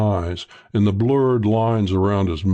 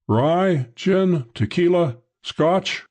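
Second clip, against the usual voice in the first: higher-pitched calling, no extra volume; calling through door; 9 semitones higher, much slower than usual. A narrator's reading. Host listing drinks from next room, calling lightly. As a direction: call out each choice separately, pitched up. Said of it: "he called through the door".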